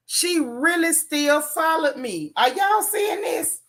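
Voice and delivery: drawn-out, rising into high-pitched incredulity; acted disbelief. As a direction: appeal to everyone in slow, mock disbelief. Acts out disbelief at being followed.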